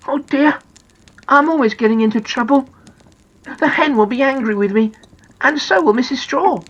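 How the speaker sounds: high-pitched, plaintive little character; Noddy, worried. Acted. Noddy frets about being in trouble, high and sorry. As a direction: lament in a high storybook voice.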